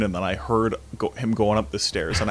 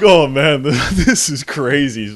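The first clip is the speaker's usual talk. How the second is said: louder, slow laughing words; laughing disbelief; 5 semitones higher, 9 dB louder, slower than usual. Retold or imitated. Student amused by the cheering car, laughing. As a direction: laugh at the absurdity.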